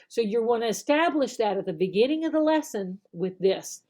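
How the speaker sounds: ordinary delivery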